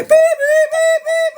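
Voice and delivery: mimicked monitor beep; alarm beeping. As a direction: imitate a shrill machine alarm. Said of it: "it literally is alarming".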